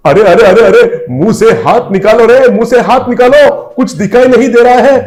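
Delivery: high, loud frantic yelling; panicked shout. Acted. Driver blinded by passenger's hand, panicked shouting. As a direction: yell blindly for the hand off your face. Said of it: "घबराते हुए".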